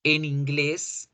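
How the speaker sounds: ordinary talk, nothing marked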